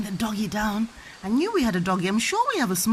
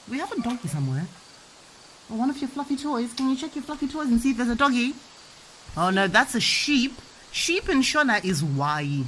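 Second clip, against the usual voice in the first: ordinary talk, nothing marked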